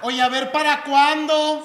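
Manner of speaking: high, loud, slow scolding lady voice; angry old lady. Acted. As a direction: nag from the back of the line. Acting angry lady in line.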